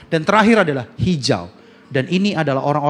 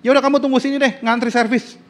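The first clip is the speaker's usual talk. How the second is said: kesal; 9 semitones higher than usual. Retold or imitated. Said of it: "Saya kesel gitu".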